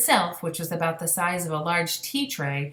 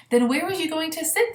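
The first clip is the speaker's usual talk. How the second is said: much higher, curious giant; high question; 11 semitones higher, 3 dB louder than usual. Acted. BFG asking Sophie where she'll sit, high and curious. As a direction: lift the voice high to ask.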